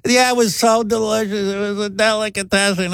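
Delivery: high, sarcastic mom; mock it like a scornful mother. Mother mocking the restaurant.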